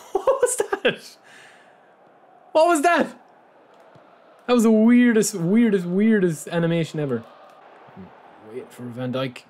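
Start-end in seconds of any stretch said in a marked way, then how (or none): 0.1-7.2 high squeaky laugh into stunned, incredulous questions; cracking up at something absurd, then loud disbelief. Real, not acted. Gamer cracks up at a glitchy match animation, laughing disbelief.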